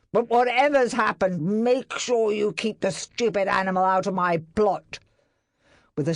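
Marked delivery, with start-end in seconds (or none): 0.1-5.0 loud, higher contemptuous warning; sneering. A narrator's reading. Barrett warning master with contempt. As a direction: look down on him.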